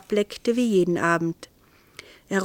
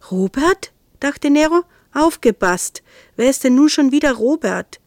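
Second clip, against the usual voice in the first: alarmed; 6 semitones higher, 5 dB louder than usual. A narrator's reading. Nero alarmed.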